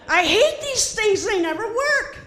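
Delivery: fed-up yelp at the sink; frustrated outburst. Retold or imitated. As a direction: vent at the broken tap. Frustrated at sensor tap.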